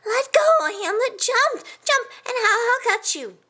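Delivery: high-pitched frantic yelling; urgent yell. A narrator's reading. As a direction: yell up to someone being carried away.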